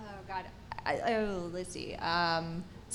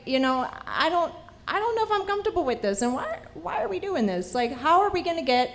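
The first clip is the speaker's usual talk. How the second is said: nervous worry; 9 semitones higher than usual. Retold or imitated. Retelling her fear to the producer, high anxious worry. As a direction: voice jumps much higher and faster. Retold worry about off-roading.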